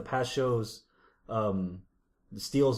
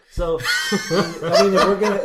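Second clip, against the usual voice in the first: laughing; 7 semitones higher, 12 dB louder than usual. Real, not acted. Laughing through his words.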